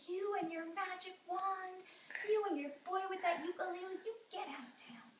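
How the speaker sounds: high, indignant scolding; stern banishment. Acted. Townspeople banishing father and boy, indignant. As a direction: draw out each accusation, then dismiss them.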